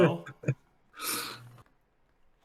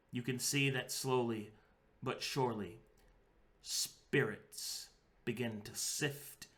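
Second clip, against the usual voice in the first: solemn, slowing scene-setting; game master narration; 3 semitones lower, 6 dB quieter than usual. A narrator's reading. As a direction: let the ending land softly. GM describes the finale.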